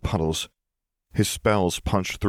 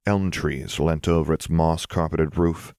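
ordinary delivery

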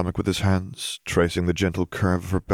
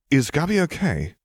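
higher-pitched, anxious check-in; concerned question; 9 semitones higher than usual. A narrator's reading. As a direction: ask it high, with concern. Worried question in dialogue.

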